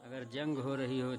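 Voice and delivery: ordinary talk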